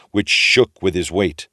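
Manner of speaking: ordinary delivery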